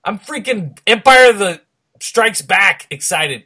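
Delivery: loud, slow, gushing excitement; excited. Real, not acted. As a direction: belt it out, overjoyed. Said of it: "that's how excited I am".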